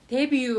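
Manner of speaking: 데뷔 said loud and clear, te-bwi; pronouncing 데뷔. Shown as a demonstration. Teacher models 데뷔 for Japanese learners, clear and careful. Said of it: "데뷔と発音します".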